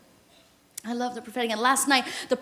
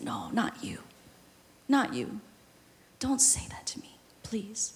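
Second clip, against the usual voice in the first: soft, hushed whisper of no, not you; whispered plea; 4 semitones lower, 12 dB quieter than usual. Retold or imitated. The barren wife whispering her refusal to the prophet. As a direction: breathe it out in a whisper. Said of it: "I didn't say that with my words".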